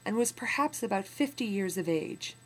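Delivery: put-on steady, measured reading of an old memoir; story narration. A narrator's reading. Teacher reading a memoir passage to students, in measured narration. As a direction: read the passage to the class with weight.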